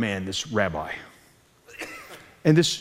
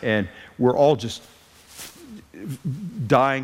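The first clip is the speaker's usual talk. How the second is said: normal talking voice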